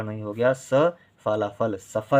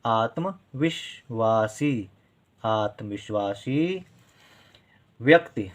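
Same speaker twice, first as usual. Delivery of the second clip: slow sounding out; slower than usual. Shown as a demonstration. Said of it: "teacher sounds out the word".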